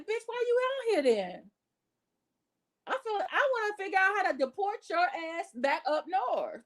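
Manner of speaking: high, mocking callout; mock her, high and sassy; sassy retort.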